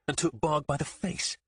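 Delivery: usual speaking voice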